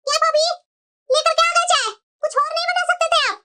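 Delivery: scornful married daughter; haughty daughter. Acted. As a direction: look down on her tea. Daughter scolds sister-in-law.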